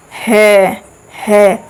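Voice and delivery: "है" with air drawn from inside the throat; isolated "है", breath pushed through the throat; glottal "ह". Shown as a demonstration. Teacher has students try saying it, glottal demo of "है". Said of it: "तो उसको बोलते हैं हम लोग स्वर यंत्र".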